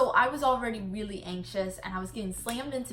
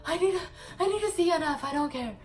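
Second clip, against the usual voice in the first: insistent; 7 semitones higher than usual. Retold or imitated. Insisting to medics.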